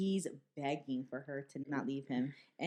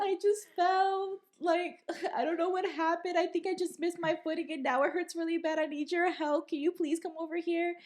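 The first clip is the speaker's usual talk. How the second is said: high, pitiful put-on plea; fake crying performance; 12 semitones higher than usual. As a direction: imitate a roommate faking hurt for help. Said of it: "I'm looking at a performance".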